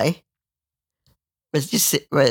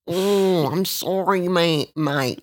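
higher, slow put-on crackhead voice; crackhead impression; 6 semitones higher, slower than usual. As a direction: whine the apology like a dopey addict. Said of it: "So then he goes".

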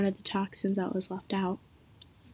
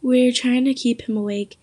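ordinary delivery, nothing marked

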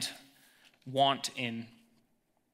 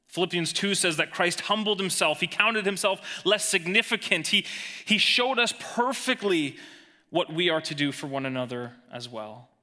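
climbing, higher and louder appeal; rising preaching; 5 semitones higher, 3 dB louder than usual. Acted. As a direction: lift the congregation with each line.

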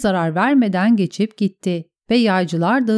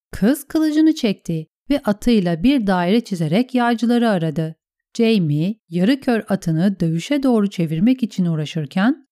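plain reading voice

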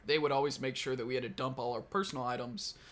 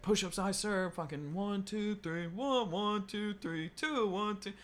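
higher-pitched recruit calling out the count; mock drill count, pitched up; 7 semitones higher, slower than usual. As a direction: imitate recruits sounding off push-up reps. Acting out recruits counting push-ups aloud.

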